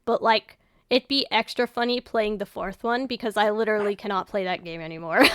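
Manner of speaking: normal talking voice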